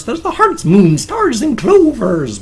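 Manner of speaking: high, loud cartoon Irish brogue; exaggerated Irish accent. Shown as a demonstration. Showing an Irish accent that is an exaggeration, hammed-up brogue. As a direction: ham up the stage-Irish lilt. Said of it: "is that an exaggeration".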